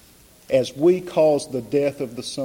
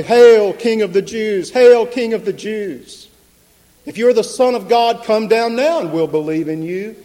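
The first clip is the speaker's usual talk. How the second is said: loud, high jeer of the soldiers; mocking shout; 7 semitones higher, 8 dB louder than usual. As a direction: hurl it as a taunt at the condemned man. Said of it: "and mock him".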